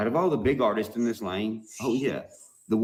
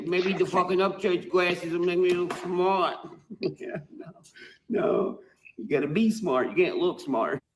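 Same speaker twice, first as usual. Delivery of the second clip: ordinary delivery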